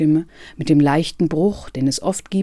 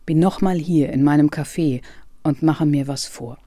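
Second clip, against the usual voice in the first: plain reading voice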